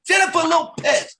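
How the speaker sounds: student copying a high shout; imitating Chris Rock. Shown as a demonstration. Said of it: "And I go".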